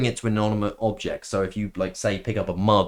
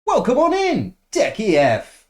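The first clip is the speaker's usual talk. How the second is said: high, loud hailing call; loud welcome; 11 semitones higher, 6 dB louder, slower than usual. Real, not acted. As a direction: shout a big high-pitched welcome. Calling out a greeting to an arrival.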